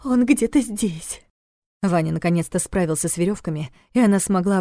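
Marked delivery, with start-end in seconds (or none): 0.0-1.2 higher, slow frightened words; fearful. A narrator's reading. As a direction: let the fear break through. Fear shows in full.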